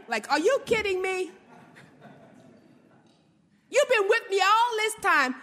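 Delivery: exasperated disbelief. Retold or imitated. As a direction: tease her, are you kidding me. Jesus retold, exasperated.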